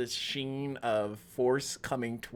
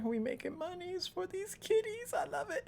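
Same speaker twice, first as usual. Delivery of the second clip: high, soft cutesy voice; silly squeaky voice; 7 dB quieter than usual. Real, not acted. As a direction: play it squeaky and cute.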